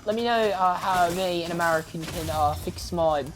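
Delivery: an American's loose British accent; fake British accent. Shown as a demonstration. Gamer greeting British viewers in a fake British accent. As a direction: hams up a British accent for the Brits. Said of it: "Back to talking to my American voice".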